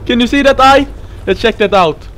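ordinary talk

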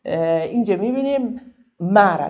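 usual speaking voice

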